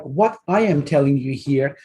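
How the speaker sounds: usual speaking voice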